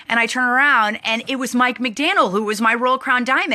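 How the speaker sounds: normal talking voice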